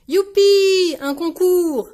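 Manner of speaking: high, very loud, stretched cheer; excited cheering cry. A narrator's reading. Children hearing of the costume contest, cheering loudly. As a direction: whoop like a crowd of thrilled kids. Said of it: "s'écrient les enfants en chœur".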